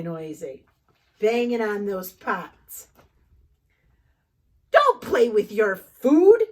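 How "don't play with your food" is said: louder firm table rule; scolding mom. Acted. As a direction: warn him at dinner.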